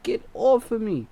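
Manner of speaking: high, loud get off; desperate plea; pained plea. Retold or imitated. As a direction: cry out in pain. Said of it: "I'm like".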